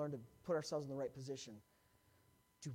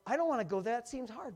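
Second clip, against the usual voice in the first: reluctant whine; 7 semitones higher, 8 dB louder than usual. Acted. Voicing reluctant self.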